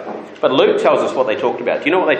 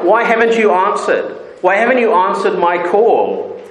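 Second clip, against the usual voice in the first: pleading God; 7 semitones higher than usual. Acted. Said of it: "tell them why, ask them why".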